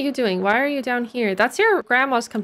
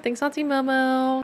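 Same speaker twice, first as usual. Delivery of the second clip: ordinary talk, nothing marked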